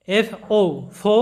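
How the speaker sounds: এফও ফো drawn out slowly, ফো with a full ও; slow spelling demo. Shown as a demonstration. Teacher slowly sounding out ফো for students. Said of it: "তাহলে আমাদের সাউন্ডগুলো এরকম হবে".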